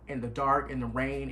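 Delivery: ordinary delivery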